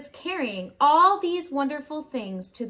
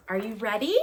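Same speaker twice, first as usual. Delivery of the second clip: ordinary delivery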